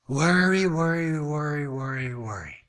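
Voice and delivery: low chant fading away; worried muttering. Acted. Squirrely believer worries all the time, fading low mutter.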